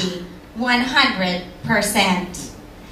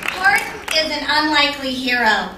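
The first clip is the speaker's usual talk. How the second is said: ordinary talk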